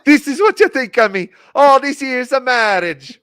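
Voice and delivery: loud, high Irish outburst; Irish accent. Acted. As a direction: shout it in a put-on Irish accent. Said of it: "Ray said they were from Ireland".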